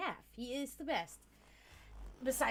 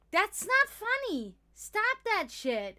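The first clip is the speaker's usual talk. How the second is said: high, loud, drawn-out scolding; mock indignant protest; 11 semitones higher, 10 dB louder, much slower than usual. Vegetarian tells teasing cook off, mock indignation. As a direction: protest loudly, half playing along. Retold protest at cook's jokes.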